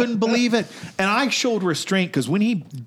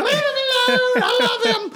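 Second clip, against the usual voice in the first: very high, louder mock wail; frantic Susie; 4 dB louder than usual. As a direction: wail it high like a desperate girlfriend.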